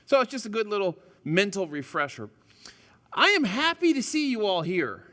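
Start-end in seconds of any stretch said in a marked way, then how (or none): 3.1-5.0 all leaned on, stretched; stress on all. Shown as a demonstration. Pastor greeting the congregation, stressing all. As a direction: make the word all count, all of you here. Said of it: "I emphasize the word all".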